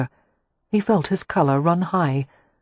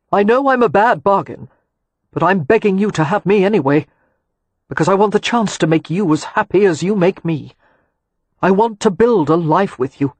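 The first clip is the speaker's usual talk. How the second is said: emotional plea; 3 semitones higher, 5 dB louder than usual. A narrator's reading. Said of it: "He fought to steady his voice".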